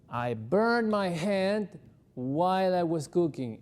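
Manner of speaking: slow demo for students; slow clear model sentence. Shown as a demonstration. Teacher models the sentence slowly for students. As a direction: spell out each word slowly for the class. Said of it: "aquí me calza mejor el poner el pasado continuo".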